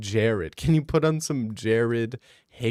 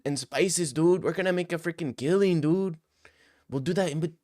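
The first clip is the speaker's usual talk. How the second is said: higher, excited buddy voice throughout; a higher, hammed-up Mexican dude impression; mock Mexican bro; 6 semitones higher than usual. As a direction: hype a friend up to go get tacos. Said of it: "You're hearing them talk like".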